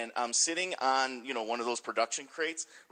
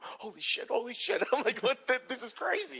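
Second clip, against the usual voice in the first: stunned disbelief; 10 semitones higher than usual. Retold or imitated. Walking back stunned by rumor.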